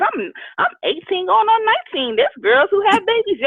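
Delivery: high, defensive comeback; indignant retort. Retold or imitated. Imagined retort to the woman on the bus, high and indignant. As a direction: fire back at the stranger, pitched high. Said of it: "in my head, I'm like".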